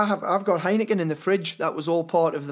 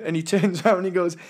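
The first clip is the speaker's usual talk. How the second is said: ordinary talk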